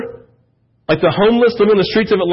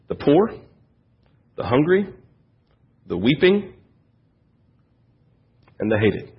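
softer, each word set apart by a pause; softer, solemn words with long pauses; hushed list; 5 semitones lower, 6 dB quieter than usual. Acted. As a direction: drop to a weighty hush for the list. Preacher lists Jesus' blessed ones.

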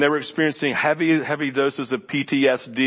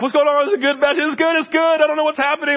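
higher and louder, bewildered excitement; excited confusion; 6 dB louder, faster than usual. Retold or imitated. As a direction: blurt it out amazed and unsure. Pastor reacts in the moment.

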